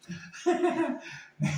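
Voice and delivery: a bright burst of real laughter; amused laugh breaking through. Real, not acted. Laughs at own retold plea.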